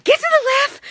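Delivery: high, loud panicked yelling; freaked-out yell. Retold or imitated. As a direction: scream a warning at her. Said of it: "I'm freaking her out".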